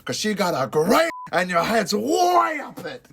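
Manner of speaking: hammed-up movie-tough-guy outburst, stretched out; Al Pacino. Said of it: "I gotta give it to Pacino".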